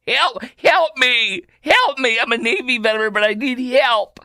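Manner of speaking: exaggerated whiny plea; mock pleading. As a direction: wail it like a helpless victim, hammed up. Parodies veteran pleading for help.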